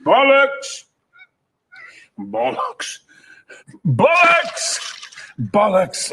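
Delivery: bursts of shouted bollocks, varying pitch; berserk rage. Acted. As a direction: swear again and again in fury. Repeated outbursts in takes.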